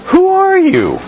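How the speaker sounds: sharp high challenge; challenging priests. Retold or imitated. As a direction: fire the question up high. Reading the priests' question.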